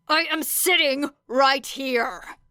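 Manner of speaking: grit it out at her; snarled. A narrator's reading. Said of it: "snarled Bakugo from across the room".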